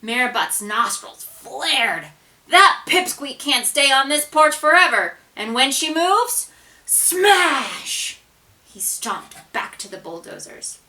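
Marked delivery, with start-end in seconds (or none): 2.5-8.2 loud, high menacing threat; angry threat. A narrator's reading. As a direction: threaten with rising fury.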